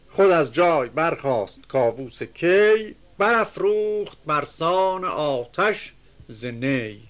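measured, chanting reading of epic verse; reciting classical Persian verse; poem recitation. Acted. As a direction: declaim the couplets with rhythm.

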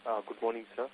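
faint, distant caller off the mic; talking from too far from the phone; phone held away. Real, not acted. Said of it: "may we request you to speak closer to the phone".

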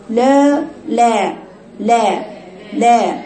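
لا repeated with a wide-open vowel; separate, held-out لا syllables; open vowel demo. Shown as a demonstration. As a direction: open the jaw on each لا, one at a time. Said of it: "صوت هناك من عند مفتوح".

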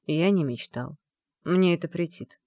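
ordinary delivery, nothing marked

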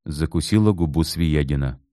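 ordinary delivery, nothing marked

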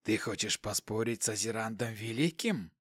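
quiet hiss with a hint of surprise; softly hissed, faintly surprised question. A narrator's reading. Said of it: "В шипящем голосе ... удивлённые нотки".

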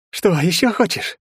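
bare your teeth at him; predatory taunt. A narrator's reading. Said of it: "хищно оскалился враг". (хищно, злорадно)

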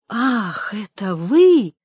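slow, swelling exclamation; drawn-out, pleased recognition; glad surprise. A narrator's reading. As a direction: greet an unexpected friend with delight. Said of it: "очевидно обрадовавшись неожиданной встрече".

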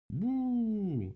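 long, high, loud drawn-out boo; crowd booing. A narrator's reading. Animals jeer the accused owl, booing loudly. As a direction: boo it out like an angry little crowd. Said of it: "закричали мышка, полевка и крот".

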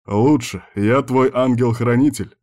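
ordinary delivery, nothing marked

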